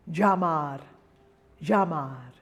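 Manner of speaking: llamar begun with a J-like Y, "zha-mar"; heavy double L. Shown as a demonstration. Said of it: "combination of a J and a Y".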